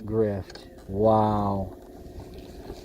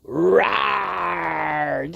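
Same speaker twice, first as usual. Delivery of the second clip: mock growl; 3 semitones higher than usual.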